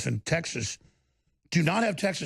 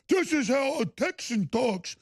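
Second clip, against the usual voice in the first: high, slow mock Texan accent; mock an outsider attempting a Texan accent, drawn out and high; fake Texan accent; 7 semitones higher than usual. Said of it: "that he's trying to".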